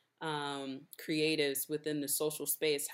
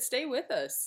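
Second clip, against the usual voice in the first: repeating it louder; 9 semitones higher than usual. Real, not acted. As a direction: stay with us, said higher and louder. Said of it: "I said".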